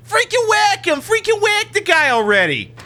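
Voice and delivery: high, whiny imitation of the mafia goon; mocking squeaky mobster. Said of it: "I can't take you seriously as some sort of major mafia foot soldier".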